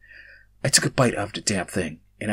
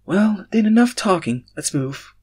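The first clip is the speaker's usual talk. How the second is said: high, loud and slow burst; cheerfully rally everyone to move on; exclaimed; 10 semitones higher, 7 dB louder, slower than usual. Acted. Robin urging the group onward, exclaiming high and loud. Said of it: "Robin exclaimed".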